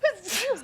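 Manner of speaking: single loud high sneeze; one sharp sneeze; sneezing. Real, not acted. Real sneezing.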